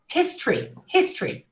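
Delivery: "history" as quick his-tree; reduced pronunciation. Shown as a demonstration. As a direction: drop the o in "history". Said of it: "not history".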